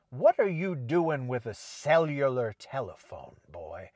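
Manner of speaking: a lightly put-on Southern sheriff drawl; quoting the Southern sheriff from Scream; sheriff's Southern accent. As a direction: play the small-town Southern sheriff questioning a boy. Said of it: "Maybe he doesn't do it in that accent".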